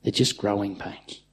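soft, hushed comfort; whispered. Retold or imitated. Father soothing his son after the massage, whispering. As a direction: breathe it gently in his ear. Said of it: "I whispered in his ear".

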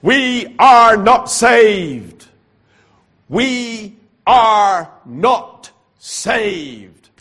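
very loud, very slow, high boom. Retold or imitated. Preacher voicing God's voice booming in the night. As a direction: boom it out like a voice from heaven. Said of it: "the voice boomed out".

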